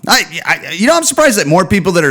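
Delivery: normal talking voice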